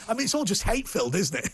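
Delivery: ordinary talk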